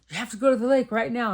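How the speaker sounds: fast, high and loud, urgent; urgent excited friend. Retold or imitated. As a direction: rush her words like breaking news. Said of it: "She says".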